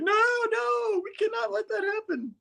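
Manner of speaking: very high, strained wail; mock panic. Spidey told he will die.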